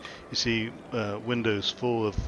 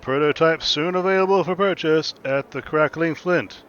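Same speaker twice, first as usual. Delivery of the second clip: loud, high hawker's announcement; high-pitched, booming street advertisement; screaming town crier; 7 semitones higher, 10 dB louder than usual. Acted. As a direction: proclaim it over the crowd at full voice. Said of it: "what are you screaming about".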